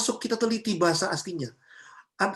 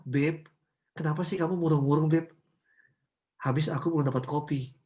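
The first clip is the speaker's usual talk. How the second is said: gentle endearments; 6 semitones lower than usual. Acted. Preacher acts out husband and wife using pet names, gently. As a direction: imitate a loving couple talking tenderly, voice dropped low. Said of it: "dia ngomong semakin hari semakin lembut".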